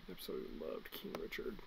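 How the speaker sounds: soft, quick whisper; whispered praise. Real, not acted. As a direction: confide it in a whisper.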